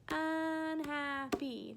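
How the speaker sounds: UNHAPPY drawn out slowly in three separate beats; "unhappy" slowly broken into its three syllables. Shown as a demonstration. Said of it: "that has three syllables".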